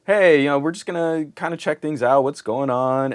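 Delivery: mock-casual doctor checking in; a light send-up of a chirpy doctor; breezy doctor. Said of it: "when a doctor's like".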